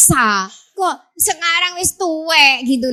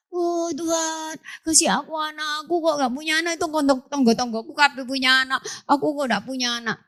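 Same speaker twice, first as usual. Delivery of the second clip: higher, soft whining prayer; soft pleading prayer; 3 semitones higher, 6 dB quieter than usual. Acted. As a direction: plead softly with God for a child. Young Zakaria praying for a child.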